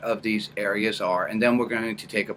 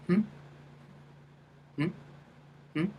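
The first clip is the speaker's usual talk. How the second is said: quick questioning hmm from the brow; little flicked hmm; 8 semitones higher, 8 dB quieter, faster than usual. Shown as a demonstration. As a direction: flick the hum up. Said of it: "so it's a little flick".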